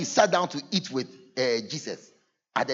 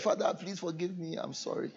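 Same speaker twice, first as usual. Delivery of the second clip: hushed plea; 10 dB quieter than usual. Retold or imitated. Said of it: "you kneel down by the bed".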